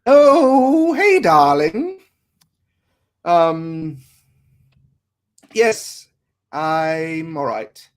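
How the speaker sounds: slow, high, loud British mother; British accent. Acted. Mother greets daughter on the phone in a British accent. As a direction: drawl it like a posh British mum. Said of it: "why am I doing a British accent?".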